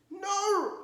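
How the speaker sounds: small muffled voice from inside. Acted. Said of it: "a small and muffled voice".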